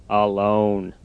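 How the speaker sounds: low, soft, slow defeated voice; glum Toad. Acted. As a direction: drag out the giving up. Toad's voice.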